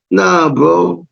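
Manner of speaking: ordinary talk, nothing marked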